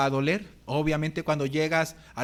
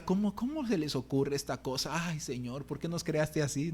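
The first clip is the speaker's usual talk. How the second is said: hushed, softer mock complaint to the Lord; a pretend plea to God, quiet and sorry for himself; mock lament; 7 dB quieter than usual. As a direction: grumble to heaven, much softer, half joking. Mock lament to God about exercise.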